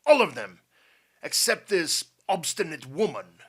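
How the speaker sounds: loud, emphatic general's command; angry order. A narrator's reading. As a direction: bark it out with exasperated force. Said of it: "ordered General Kalik".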